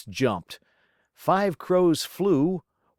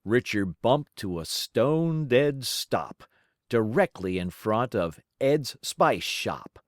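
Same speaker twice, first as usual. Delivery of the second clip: plain reading voice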